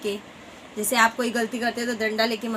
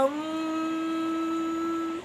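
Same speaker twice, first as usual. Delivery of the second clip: whimper; 6 semitones higher, 4 dB quieter, much slower than usual. Acted. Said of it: "वहाँ रो रहा था".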